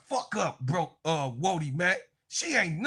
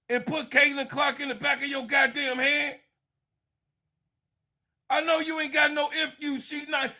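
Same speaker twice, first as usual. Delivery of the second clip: ordinary delivery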